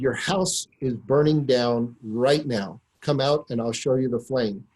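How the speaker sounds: ordinary talk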